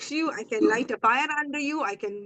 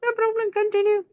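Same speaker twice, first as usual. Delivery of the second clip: high, sing-song brush-off, a bit louder; breezy dismissal; 10 semitones higher, 5 dB louder than usual. Retold or imitated. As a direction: airily wave the apology away, pitched up high. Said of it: "And I'm like".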